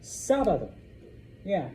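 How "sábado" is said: "Sábado" as SÁ-ba-do, SÁ loud and high; stress on first A. Shown as a demonstration. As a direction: punch the SÁ in "Sábado". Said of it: "we put an accent mark on the A".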